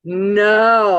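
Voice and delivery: loud, drawn-out no; mock-shocked, booming no; retold emphatic denial. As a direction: imitate an alarmed doctor refusing. Retold doctor's alarmed denial.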